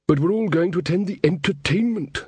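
put-on a fretful young king; nervous young king. Verence voiced.

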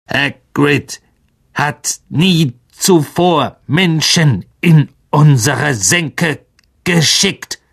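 slow, high eerie creature voice; old spider. A narrator's reading. Aragog doubts the boys, slow creature voice. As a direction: ancient monster pondering. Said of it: "sagte er".

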